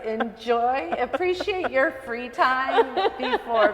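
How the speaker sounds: giggling while giving advice; laughing advice. Real, not acted. Mom advising the interviewer about free time, laughing.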